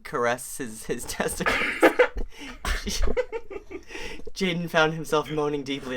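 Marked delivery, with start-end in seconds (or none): 1.6-4.3 high breathless giggling fit; wheezing laugh. Real, not acted. Reader can't get through the explicit line, wheezing with laughter. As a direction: lose it in silent giggles. Cracks up reading.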